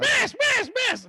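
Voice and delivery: high, squawking granny; cartoon old lady. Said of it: "I'm an old lady".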